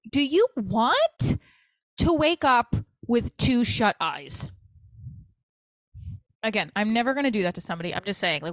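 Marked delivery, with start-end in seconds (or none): 0.1-4.5 slow, loud, deliberate mock threat; exasperated threat, drawn out and loud. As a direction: hammer out each word as a warning. Imagined angry threat to him.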